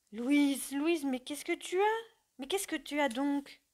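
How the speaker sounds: high, anxious pleading questions; distraught. Acted. Husband pleads with his weeping wife, distraught. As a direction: beg her to answer, frightened. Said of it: "Roland, éperdu".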